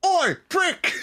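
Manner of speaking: very high British yell; Shaun of the Dead. Acted. Guest quotes a British film for laughs, high mock yell. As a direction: call it out in a squawking British accent. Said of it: "do the Shaun of the Dead".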